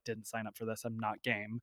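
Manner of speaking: a private grumble nobody should hear; muttered inner thought. Retold or imitated. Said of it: "I was like".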